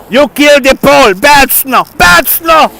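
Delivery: loud, high scolding shout; mock scolding. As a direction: yell at the snow like a bad dog. Scolding the snow plough.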